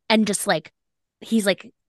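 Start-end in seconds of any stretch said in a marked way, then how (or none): none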